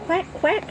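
high loud duck quack; boy catching on to the game, loud quacking; loud mock quack. A narrator's reading. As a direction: quack loudly to join the game. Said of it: "Henry says loudly".

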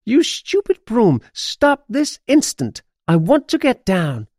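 high-pitched acted outrage; indignant scolding. A narrator's reading. Witch yelling at her runaway broom, high and cross.

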